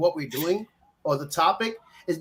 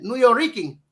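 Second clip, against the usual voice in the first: "New York Rican" in a light Spanish accent, a bit higher; 4 semitones higher, 3 dB louder than usual. Shown as a demonstration. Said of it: "you gotta say with a Spanish accent".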